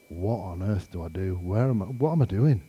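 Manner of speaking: soft, low worried muttering to self; hushed, lost self-questioning; worried inner voice. Retold or imitated. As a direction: retell anxious thoughts under the breath. Retold worried thoughts, stranded after dark.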